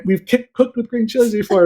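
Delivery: loud, high-pitched mock protest; retold frustration. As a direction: re-enact insisting in frustration. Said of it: "I got really frustrated".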